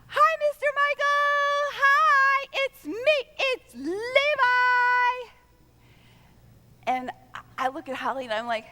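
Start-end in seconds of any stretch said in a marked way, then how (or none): 0.1-5.3 put-on high loud child's shout, drawn out; child yelling. Grandson shouting at the church from car seat, high loud yell. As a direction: call out like a thrilled three-year-old. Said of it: "he yells really loudly".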